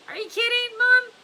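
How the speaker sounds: very high, loud scoff; scoffing disbelief. Acted. X-Ray scoffing at Mister Pendanski as Mom, very high and loud. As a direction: squeal it in mock disbelief.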